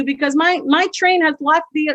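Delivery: normal talking voice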